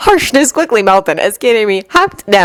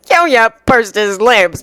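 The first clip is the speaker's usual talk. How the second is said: ordinary delivery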